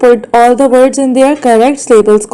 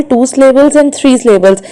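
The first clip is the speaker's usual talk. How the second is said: ordinary delivery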